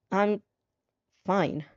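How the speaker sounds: flat robotic recitation. Acted. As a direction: recite it like a machine, no feeling. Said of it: "You recite robotically".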